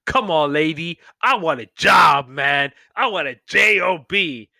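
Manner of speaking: loud, insistent ad character; hammed-up job seeker's demand; acted pleading demand. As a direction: shout for work, hamming up the exasperation.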